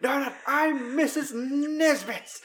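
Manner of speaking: put-on high, slow, prim old lady voice; high dreamy falsetto of a toy who thinks she's Missus Nesbit; dotty Missus Nesbit. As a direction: sing it out slowly like a prim tea-party hostess. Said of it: "He's like".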